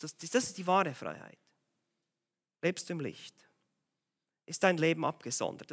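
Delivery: normal talking voice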